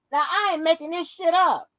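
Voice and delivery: usual speaking voice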